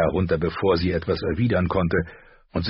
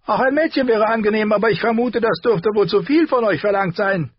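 much higher and louder, mocking "ich"; mocking dwarf voice; 5 dB louder than usual. A narrator's reading. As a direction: echo her order as a sneering joke. Tyrion jokes on. (hohe, spöttische Nachäffung des Befehls)

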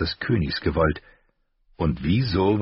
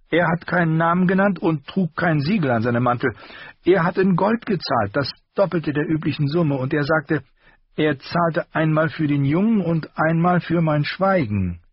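higher, sharper armourer voice; the master's voice; 11 semitones higher, 3 dB louder than usual. A narrator's reading. Armourer rebuking his apprentice, higher voice. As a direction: scold the boy in a raised voice.

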